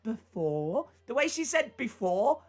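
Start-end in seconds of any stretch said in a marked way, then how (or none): 0.0-0.9 "before" rising like a question. Retold or imitated. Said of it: "put that little question mark". 1.8-2.4 "before" pitched up as a question. Retold or imitated. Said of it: "was like a question".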